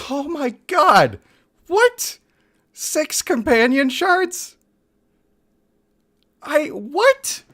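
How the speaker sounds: very high, loud shocked outburst; high-pitched, drawn-out gasping disbelief; stunned disbelief. Real, not acted. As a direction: cry out in real amazement. Real shock at rare game pull.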